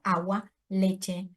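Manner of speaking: "agua, leche" said very slowly; teacher's slow demo of early words; slow example words. Shown as a demonstration. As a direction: spell out each first word for the class.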